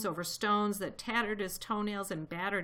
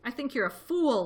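ordinary delivery, nothing marked

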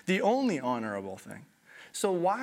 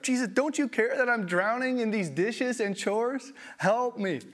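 high, loud exasperated plea; overwhelmed sister crying for help; frantic Martha; 9 semitones higher, 4 dB louder than usual. Acted. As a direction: beg like someone drowning in chores. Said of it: "Now Martha comes up".

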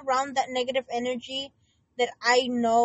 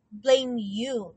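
normal talking voice

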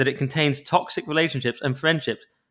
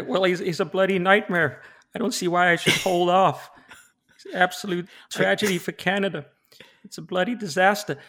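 Peterson impression; 4 semitones higher than usual. [name] answers as Peterson.

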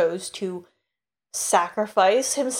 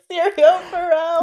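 emotional cry; 9 semitones higher, 6 dB louder than usual. Real, not acted. Voicing emotional quote.

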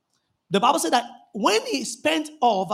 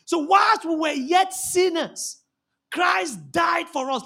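preaching at full force; 6 semitones higher, slower than usual. Acted. Rereads the verse, slow and loud.

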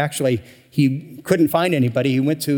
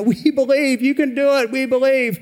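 high, mock crowd shout of belief; crowd shouting back; 11 semitones higher than usual. Crowd answering the tightrope walker, mock shout. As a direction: mimic the crowd calling back to Blondin. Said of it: "they all shouted back".

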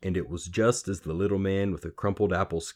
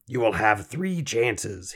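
slow, higher croaked voice; croaky little man, ominous; 4 semitones higher, 4 dB louder, slower than usual. A narrator's reading. Little man setting girl a challenge, ominous. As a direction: pronounce the terms slowly.

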